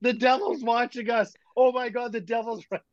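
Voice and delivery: high, panicked yell that the devil is here; terrified yell. Retold or imitated. Kid at the campfire yelling to friends, high panicked cry. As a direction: shriek it out like a scared boy seeing the devil. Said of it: "He yells at the top of his lungs".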